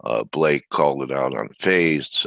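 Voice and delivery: ordinary talk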